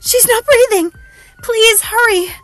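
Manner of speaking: very high, loud frantic cry; gasped panic. A narrator's reading. Helena begs the operator to hurry, gasping in panic. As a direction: gasp and plead for help. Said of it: "she gasped".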